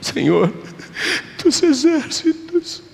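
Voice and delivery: high, slow, tearful plea; weeping prayer. Retold or imitated. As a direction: cry out to God like Hannah in tears. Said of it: "Ela dizia isso chorando".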